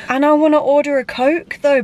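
ordinary talk, nothing marked

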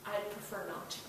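barely voiced, like a weak tired old man; sick old man. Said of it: "sick man, says".

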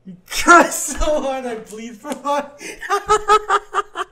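slow, high, breaking into laughter; laughing through words. Real, not acted. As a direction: read it while cracking up. Cracking up over own typed joke.

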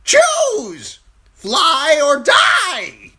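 high, slow crow shriek; shouting crow. A narrator's reading. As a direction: scream the command like a wild bird.

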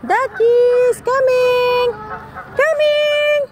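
calling high and bright to ducks; excited high call. Real, not acted. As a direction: sing out to the ducklings.

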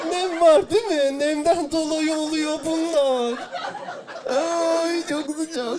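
high, fussy mock whine about the humidity; mock complainer. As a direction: play a delicate visitor fussing over the damp. Mocking people who complain of humidity. (yüksek sesle nazlı sızlanma taklidi)

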